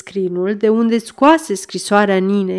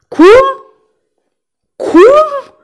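high, louder incredulous question; startled interruption; 7 dB louder than usual. A narrator's reading. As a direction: break in, astonished, pitch leaping up. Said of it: "se întrerupse Fanferlot". (întrebare uluită, pe ton foarte înalt)

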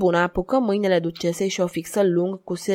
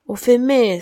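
raised, higher-pitched exclamation; startled cry; 4 semitones higher, 5 dB louder than usual. A narrator's reading. Madwoman recalling the woman who came, crying out. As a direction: cry out at the sudden memory. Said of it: "strigă Margentin".

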